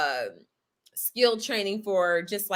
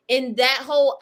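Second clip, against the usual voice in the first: ordinary talk, nothing marked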